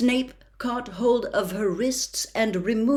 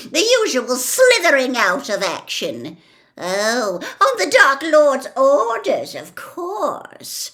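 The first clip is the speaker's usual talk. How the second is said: high, loud, laughing sneer; mocking Bellatrix; 7 semitones higher, 8 dB louder than usual. A narrator's reading. Bellatrix mocks Snape to her sister, laughing and loud. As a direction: jeer at his empty promises. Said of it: "cackle of triumphant laughter".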